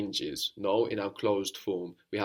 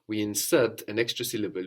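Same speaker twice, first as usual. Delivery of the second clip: usual speaking voice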